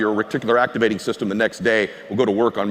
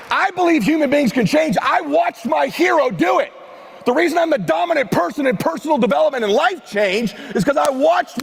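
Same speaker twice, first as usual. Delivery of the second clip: much higher-pitched, fervent stage preaching; impassioned motivational speech; 13 semitones higher than usual. Acted. Speaker urging the crowd that people can change, fervent. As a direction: drive every line home to the crowd.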